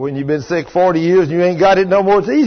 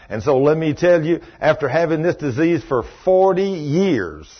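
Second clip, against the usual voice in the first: usual speaking voice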